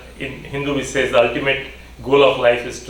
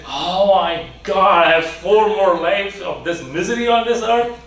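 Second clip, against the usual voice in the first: despairing groan; 5 semitones higher than usual. Acted. Man hears of four lives.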